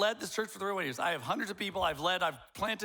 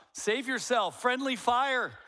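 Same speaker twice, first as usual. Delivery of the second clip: higher, louder joking cry of alarm; dad playfully yelling a warning; a hammed-up battlefield call; mock yell; 7 semitones higher, 5 dB louder, slower than usual. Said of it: "my dad would yell out".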